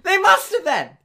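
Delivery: very high, loud protest; indignant shout. Real, not acted. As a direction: insist at the top of your voice. Keeps ranting about the used cards.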